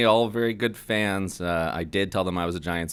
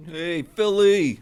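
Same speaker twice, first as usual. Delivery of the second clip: high, drawn-out Philly fan call; Philly greeting; 9 semitones higher, much slower than usual. Pretend.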